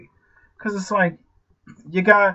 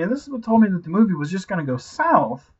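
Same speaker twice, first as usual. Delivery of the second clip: ordinary talk, nothing marked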